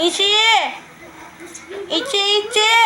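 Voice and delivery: cutesy, high-pitched summons; calling a pet. Real, not acted. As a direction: call the cat in a high little voice. Calling the cat by pet name.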